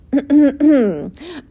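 pointed, theatrical throat clear. A narrator's reading. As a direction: clear your throat before an announcement. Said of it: "So Wilbur cleared his throat".

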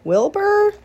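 high, loud call across the barn. A narrator's reading. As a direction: call Wilbur to wake him. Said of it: "she called".

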